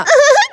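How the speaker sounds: high, gleeful little laugh; sly laugh. Acted. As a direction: laugh at the trapped soldier. Ghost woman reveals the trap. (หัวเราะเจ้าเล่ห์)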